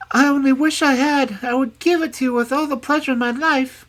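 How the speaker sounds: very high, loud pleading princess; earnest, distressed princess. A narrator's reading. Princess assuring the hag she has no money, earnestly.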